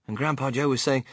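ordinary delivery, nothing marked

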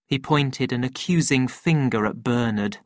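ordinary delivery, nothing marked

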